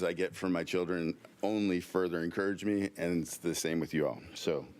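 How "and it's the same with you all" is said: normal talking voice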